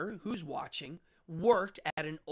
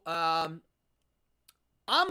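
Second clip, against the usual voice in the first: ordinary talk, nothing marked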